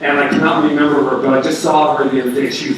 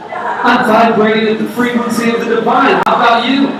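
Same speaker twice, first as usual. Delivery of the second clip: beam it out to a passer-by; cheery greeting; 6 semitones higher than usual. Retold or imitated. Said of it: "everybody can be walking around saying".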